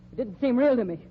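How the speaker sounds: normal talking voice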